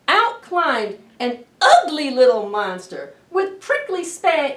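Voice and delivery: expressive picture book reading; reading to children. A narrator's reading. Librarian reads a monster story to children. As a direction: read it big and lively for little ones.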